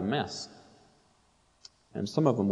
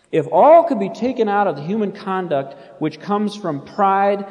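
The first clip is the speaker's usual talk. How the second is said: reading aloud with fervor; 3 semitones higher, 6 dB louder than usual. Acted. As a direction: declaim the commentary like a pulpit reading. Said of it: "He says".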